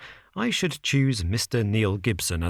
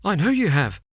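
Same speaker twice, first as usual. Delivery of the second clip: breathless, higher and louder; gasped; 8 semitones higher, 4 dB louder than usual. Acted. Frightened visitor gasps out to Holmes, breathless. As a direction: blurt it out, short of breath. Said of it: "our visitor gasped".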